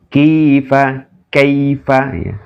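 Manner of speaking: "Kifa" with a long i, "kaifa" with a short ai; mad versus lin. Shown as a demonstration. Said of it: "Kaifa bukan kai, tapi kai ya".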